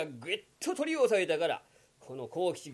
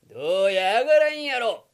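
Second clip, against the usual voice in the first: louder, crowing taunt; loud gloating; 4 semitones higher, 9 dB louder than usual. Acted. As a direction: rub it in, loud. (大声で勝ち誇る)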